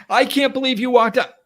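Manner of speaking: high loud mock fury; furious caller. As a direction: play the caller outraged at being hung up on. Voicing the angry partner.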